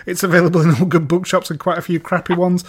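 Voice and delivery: ordinary delivery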